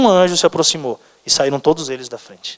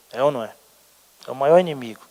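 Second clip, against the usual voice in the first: ordinary delivery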